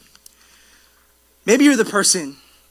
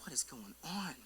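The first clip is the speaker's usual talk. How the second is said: breathless whispered question; stunned whisper; 4 semitones lower, 24 dB quieter than usual. Pretend. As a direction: whisper in quiet disbelief. Dad stunned at playground chaos.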